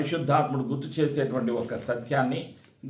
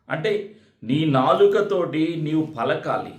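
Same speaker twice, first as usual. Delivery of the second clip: ordinary delivery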